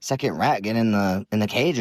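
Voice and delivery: ordinary talk, nothing marked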